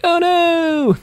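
very high cartoon squeal of fright; mock panic. Gamer voices himself getting caught, mock panic squeal. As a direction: squeak it like a scared kid. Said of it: "I'm all like".

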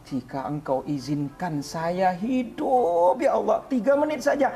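tearful plea climbing to a high wail; re-enacted desperate cry to God; crying, screaming prayer. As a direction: draw out the words, then wail them upward. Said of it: "nangis sama Allah".

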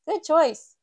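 high, loud salesman's voice; Mister Fox praising the customer, high and loud; shopkeeper Mister Fox. A narrator's reading. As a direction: sell it with a bright shopkeeper lift. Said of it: "says Mister Fox".

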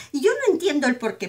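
usual speaking voice

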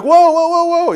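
high, loud startled whoa, whoa; alarmed whoa. Retold or imitated. Hacker watching the phishing spread, startled and loud. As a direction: pull up short as it gets out of control. Reacting as attack spirals out.

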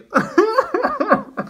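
high-pitched mock sob breaking into laughter; fake crying. Showing the sad photo pose, fake crying. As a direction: pretend to cry but laugh instead. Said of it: "this one's, like, me crying".

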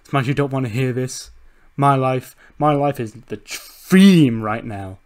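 loud boast with key words punched; gloating wrestler promo. Acted. Acts happy wrestler on the mic.

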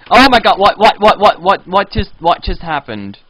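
loud, rapid shocked babble; loud shocked what-what-what; startled. Real, not acted. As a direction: blurt out in shock. Sudden fall in game.

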